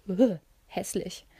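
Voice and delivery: slow, disgusted groan of dislike. A narrator's reading. Narrator wrinkling her nose at a pink dress, disgusted. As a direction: turn your nose up, drawing it out. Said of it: "Ich rümpfte die Nase".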